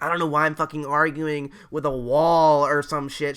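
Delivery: loud imitation of an angry teacher; mock blow-up. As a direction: act out the teacher losing it, loudly. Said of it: "she blew the fuck up".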